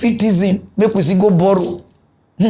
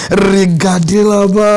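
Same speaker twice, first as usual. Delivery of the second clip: slow, drawn-out announcing call; catchphrase call-out; 4 dB louder, slower than usual. Acted. Host cueing the pastor's clip, drawn-out call. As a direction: stretch it out like a show catchphrase.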